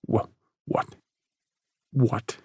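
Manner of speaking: breathless shocked stammer; stammering, stunned. Acted. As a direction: stumble over the word. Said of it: "Parker stammered".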